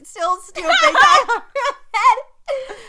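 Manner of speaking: high, loud burst of laughter mid-sentence; laughing. Real, not acted. Telling a friend about a clueless crush, laughing. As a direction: crack up loudly while telling it.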